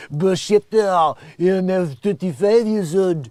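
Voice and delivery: put-on slow, loud French-accented gibberish; over-the-top French babble. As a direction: mangle it in a thick mock French accent. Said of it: "when he's gonna go French again".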